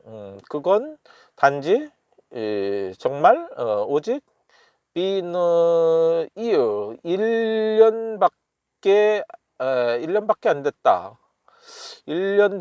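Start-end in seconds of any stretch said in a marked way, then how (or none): none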